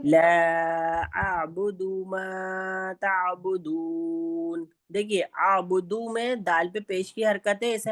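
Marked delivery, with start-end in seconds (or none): 0.0-4.6 very slow chanted recitation, vowels drawn long; Quran recitation. Shown as a demonstration. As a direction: model the verse slowly while class listens. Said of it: "آپ لوگ صرف سنیے".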